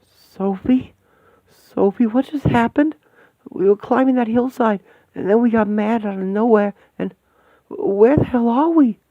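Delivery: high, loud, bewildered calls; lost and frightened, calling out; panicked confusion. Acted. To Sophie after waking somewhere strange, panicked and high.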